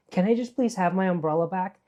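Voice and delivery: put-on high, pleading five-year-old on the verge of tears; childlike plea. Begging the clerks for her umbrella, high childlike plea. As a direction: beg like a small child near crying. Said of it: "I became a child".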